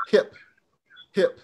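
hip said short and clipped off; sharp snappy het. Shown as a demonstration. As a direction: snap out hip, hip quick and crisp. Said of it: "It's very sharp, snappy".

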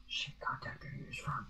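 hushed whisper. Acted. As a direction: whisper it under your breath. Hushed aside during roleplay.

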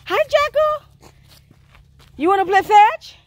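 very high, loud, drawn-out hello; coaxing sweet talk. Real, not acted. Woman invites Jacko to play fetch, high and loud coaxing. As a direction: coax a buddy to play in a high sing-out.

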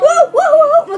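high, loud alarmed whoas; startled whoa. Real, not acted. Bracing before tasting bean.

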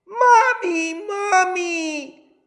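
put-on high, loud frightened children calling; scared cry. A narrator's reading. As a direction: call out to Mommy in fear. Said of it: "they cried out".

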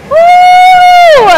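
high whooping cheer; excited whoop. Real, not acted. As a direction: let out a loud, high woo. Coach whoops at final push.

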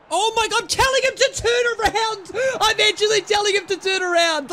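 loud, high, disbelieving yell; incredulous shout. Real, not acted. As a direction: shout in total disbelief at the game. Disbelief at player's move.

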